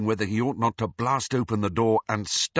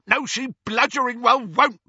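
high, loud angry bellow; 14 semitones higher, 6 dB louder than usual. A narrator's reading. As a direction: bellow it back. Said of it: "bellowed Amycus".

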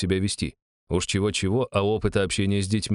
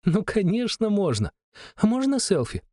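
put-on high excited child voice; little girl voice; 12 semitones higher than usual. A narrator's reading. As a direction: beg happily like a small kid. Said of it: "girl asks for selfie".

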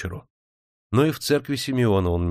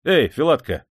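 calling out; 6 semitones higher, 5 dB louder than usual. A narrator's reading. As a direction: call out loud and higher across the carriage. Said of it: "позвал Свистунов". (громко окликая)

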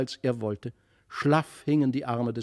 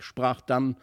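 plain reading voice

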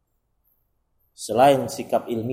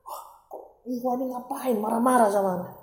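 irritated retort; 9 semitones higher, 4 dB quieter than usual. Retold or imitated. Annoyed husband retorting.

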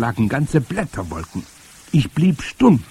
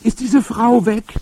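put-on high, loud child's call; boy shouting from the tree; 7 dB louder than usual. A narrator's reading. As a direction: call urgently down for grandmother. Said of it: "rief ich".

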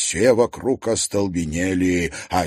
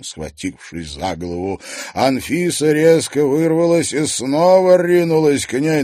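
ordinary delivery

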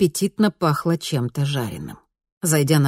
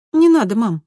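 put-on higher, brighter girl voice; lightly voiced cheerful teen girl; bright daughter voice; 7 semitones higher, 6 dB louder than usual. A narrator's reading. Daughter reassuring mother.

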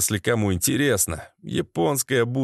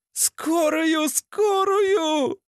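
very high, wailing shriek; howling administrator; much slower than usual. A narrator's reading. Receptionist joins the wounded man's cries, wailing shrilly. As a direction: howl it like a panicked woman, sky-high. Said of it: "В унисон взвыла администраторша".

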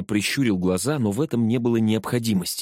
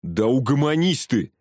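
mildly raised angry bark; irritated, higher-pitched order; 4 semitones higher, 3 dB louder than usual. A narrator's reading. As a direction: snap at him to settle down. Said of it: "рявкнул амбал".

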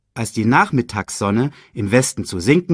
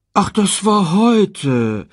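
high, drawn-out fake dismay; feigned disappointment; 11 semitones higher, 4 dB louder, much slower than usual. A narrator's reading. Scar pretends he forgot the ceremony, feigned disappointment. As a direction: play disappointed, slow and high. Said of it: "spielte den Enttäuschten".

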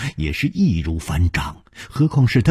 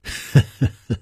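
light chuckle; 3 semitones higher than usual. A narrator's reading. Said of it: "只是微笑着说".

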